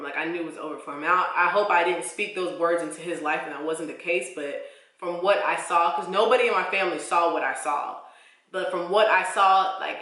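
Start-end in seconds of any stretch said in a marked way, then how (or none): none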